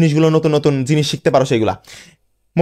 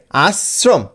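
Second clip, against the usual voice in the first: Ashram split "as... srom"; piece by piece; much slower than usual. Shown as a demonstration. Teacher introduces the word, Ashram in two separate pieces. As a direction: pause inside Ashram after "as". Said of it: "টুকরো টুকরো করে উচ্চারণ".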